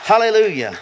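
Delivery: hallelujah called out high and loud; praise shout. Real, not acted. Pastor leading the congregation's praise, shouting hallelujah. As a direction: lift the room with each hallelujah.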